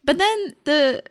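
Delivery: ordinary delivery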